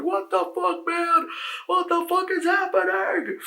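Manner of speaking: very high, loud mock scream of terror; panicked scream. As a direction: shriek it like monsters are coming. People torn apart, screaming.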